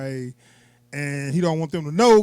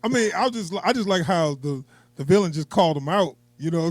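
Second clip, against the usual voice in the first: usual speaking voice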